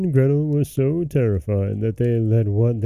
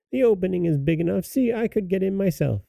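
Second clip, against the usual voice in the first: high-pitched witch voice; hag; 8 semitones higher, 4 dB quieter, faster than usual. A narrator's reading. Hag showing Gretel the oven opening, high voice. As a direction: show her up in a high hag voice. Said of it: "said the hag".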